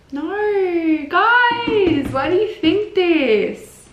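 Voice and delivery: high, loud, drawn-out plea; exasperated protest. Real, not acted. To fans about breakup rumors, exasperated protest.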